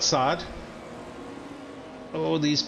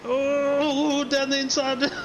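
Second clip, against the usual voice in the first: high, thrilled exclamation; excited; 10 semitones higher, much slower than usual. Real, not acted. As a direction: gasp out the overtake, excited. Excited reaction to overtake.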